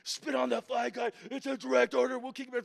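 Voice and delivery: high-pitched overlapping-orders yell. Retold or imitated. Drill instructors threaten the recruit, high yelling. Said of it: "They're all yelling".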